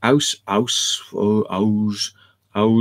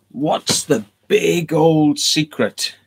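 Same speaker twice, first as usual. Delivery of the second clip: slow, high, eerie voice on each word; spooky drawn-out question; 6 semitones higher, much slower than usual. Acted. Spooking the viewers with the Freemason question, slow and eerie. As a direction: stretch it out like a campfire ghost tale.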